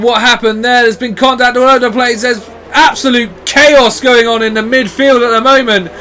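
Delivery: ordinary talk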